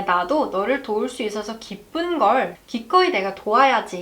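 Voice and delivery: ordinary delivery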